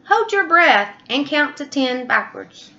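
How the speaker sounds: zebra voice. Acted. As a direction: pitch it up bright and eager. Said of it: "Zebra said".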